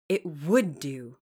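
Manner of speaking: disdainful affirmation. A narrator's reading. Jane answering St. John, slow and disdainful. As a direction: set it down firmly, looking down on his doubt. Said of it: "I affirmed with some disdain".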